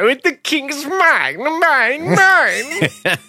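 very high, slow whiny mine, mine, mine; a spoiled cartoon king clutching his gold.

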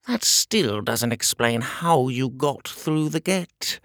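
slow, pressing royal voice; insistent little tyrant; pompous shrill tyrant. Acted. King voice held.

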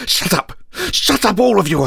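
contained, understated scream; mild acted scream, not at full volume; screamed protest. A narrator's reading. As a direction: cut them off in strained anger. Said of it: "I screamed".

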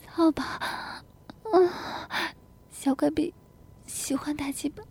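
high, breath-heavy moaning delivery; trembling soft cries. A narrator's reading. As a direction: gasp between short phrases. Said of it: "小嘴颤抖地轻声叫道".

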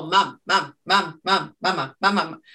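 lightly voiced nagging cartoon child; a hint of a kid pestering mom; whiny cartoon kid. Imitating Stewie nagging his mom, light whiny repeat. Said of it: "like Stuart Griffin on Family Guy".

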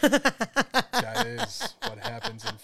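loud, slow, laughing echo; cracking up mid-line. Real, not acted. Echoes friend's line, laughing.

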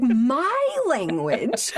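high, stretched-out mock outrage; worked up, indignant. He is told to watch his language, mock indignant. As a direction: draw out the words in hammed-up disbelief. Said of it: "You seem very worked up".

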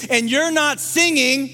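loud, high, impassioned preaching; building preacher's cry; preaching at full force. Acted. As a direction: lift the congregation with urgent, soaring delivery.

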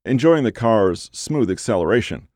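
usual speaking voice

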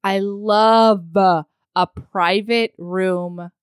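slow, drawn-out delight; slow, savoring. Real, not acted. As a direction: linger on every word lovingly. Savoring favorite thing, repeated.

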